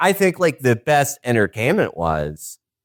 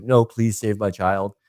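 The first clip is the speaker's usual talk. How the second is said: ordinary delivery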